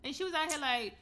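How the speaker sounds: normal talking voice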